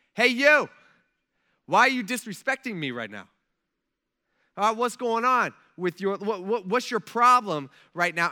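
loud, acted indignant challenge; angry confrontation. Offended king confronting Nehemiah, loud and indignant.

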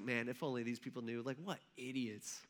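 low, soft aside to himself; muttered scorn. Acted. Old John muttering scorn while writing.

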